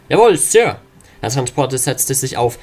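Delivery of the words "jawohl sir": higher, loud shouted yes sir; soldier shout. Acted. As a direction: snap back the reply. Soldiers answer order.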